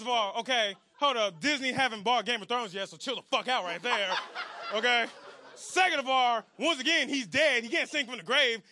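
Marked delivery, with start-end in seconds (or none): none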